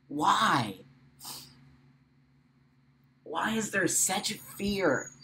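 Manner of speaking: soft, broken-up speech with long silences; real tears, nearly whispering in places; crying, heartbroken. Real, not acted. Vlogger asking own community why they hate each other, quietly crying. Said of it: "I'm crying because my heart hurts".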